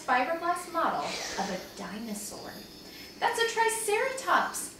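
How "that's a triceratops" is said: put-on high-pitched, sure-of-himself little boy; proud child stating a fact; confident. A narrator's reading. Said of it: "Benny said confidently".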